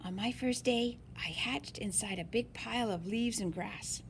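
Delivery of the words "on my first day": usual speaking voice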